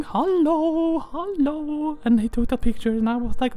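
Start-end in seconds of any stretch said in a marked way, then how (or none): 0.0-2.0 lilting higher greeting while waving; higher sing-song hello. Retold or imitated. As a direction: call hello high and bright. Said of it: "like, waving".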